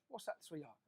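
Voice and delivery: put-on soft, tender question to a child; gentle fatherly reply. A father softly answering his daughter, tender. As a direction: ease into it quietly like a dad at bedtime.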